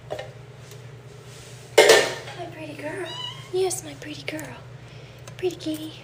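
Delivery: high, drawn-out coo to a kitty; cooing pet talk. Real, not acted. Owner praising her pretty kitty, cooing high.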